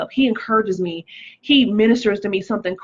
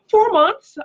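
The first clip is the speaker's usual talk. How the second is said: much higher and louder, disbelieving "Four months?"; shocked; 13 semitones higher, 6 dB louder than usual. Real, not acted. Reacting to a viewer's advice on recovery time, shocked. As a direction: shriek out the number in disbelief.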